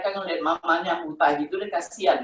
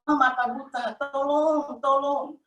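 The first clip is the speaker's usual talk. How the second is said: high-pitched, restrained mock yell; retold cry for help; 7 semitones higher than usual. Blinded mother calling her family for help, mild retold scream. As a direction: call for help, pitch raised without real volume. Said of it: "Saya jerit suami saya, jerit".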